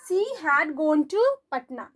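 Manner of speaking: slow, word by word dictation; teacher dictating at a crawl. Shown as a demonstration. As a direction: spell it out slowly for students to write. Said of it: "dictating the sentence slowly".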